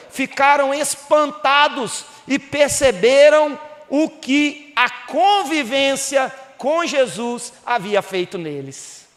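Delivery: ordinary delivery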